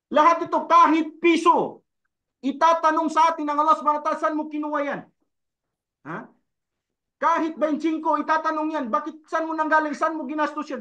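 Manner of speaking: emphatic, urgent preacher; preaching at full force. Acted. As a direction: press the warning on the congregation. Preaching about judgment.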